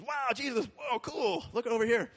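high, soft awed exclamation; a kid amazed at something cool; excited wonder. Acted. Peter seeing Jesus walk on water, awed and excited.

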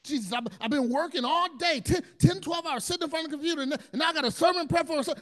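high, loud, fast exhausted pleading; frantic plea. Retold or imitated. Overworked pastor pleading with Jesus, high loud frantic. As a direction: pour it out near breaking.

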